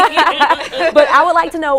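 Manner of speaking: high laugh breaking into the question; real laughter. Real, not acted. Host laughs after congratulating guest.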